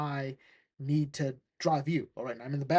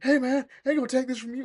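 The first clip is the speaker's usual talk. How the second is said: higher, louder, fast comic outburst; indignant protest; 9 semitones higher, 5 dB louder, faster than usual. Retold or imitated. Tim standing up to the police officer, mock indignant. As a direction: imitate a kid standing up to someone.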